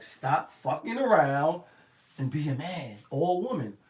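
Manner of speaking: usual speaking voice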